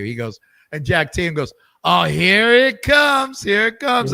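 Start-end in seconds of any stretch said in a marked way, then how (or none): 1.8-4.1 knowing groan. Retold or imitated. Tatum braces for the question.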